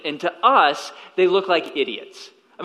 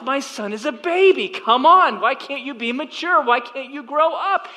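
retold griping, pitched up and insistent; exasperated inner voice; 7 semitones higher than usual. Father griping to himself about his immature son, exasperated. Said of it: "I'm thinking to myself".

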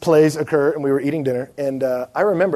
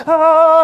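a loud, high sung blast; imitating the orchestra hitting a note together; imitated orchestra hit; 14 semitones higher, 9 dB louder than usual. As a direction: belt it like the orchestra's big chord.

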